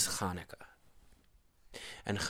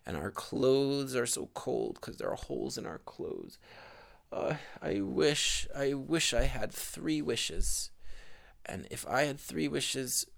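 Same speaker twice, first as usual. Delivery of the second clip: put-on higher, pleading old woman's lament; poor old woman lamenting; 5 semitones higher than usual. Old woman crying to her husband, high pleading lament. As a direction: plead like a poor old wife with nothing left.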